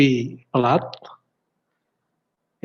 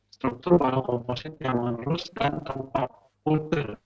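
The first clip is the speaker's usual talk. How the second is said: corrupted glitchy audio; 6 dB quieter than usual. Real, not acted. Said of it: "suaranya putus-putus".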